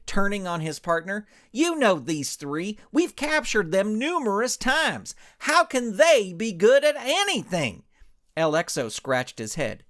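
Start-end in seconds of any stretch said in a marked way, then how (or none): 1.5-7.8 indignant cowboy hero. Acted. Ranch hand complains to his partners, indignant and loud. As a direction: puff up as a wounded hero cowboy.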